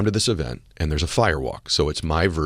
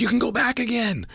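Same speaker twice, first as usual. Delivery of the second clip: much higher, sing-song coaxing; mock encouraging friends. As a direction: imitate the group urging him back. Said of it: "They're like".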